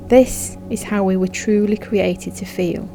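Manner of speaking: normal talking voice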